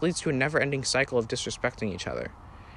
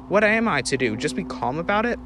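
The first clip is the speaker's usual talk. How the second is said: ordinary delivery, nothing marked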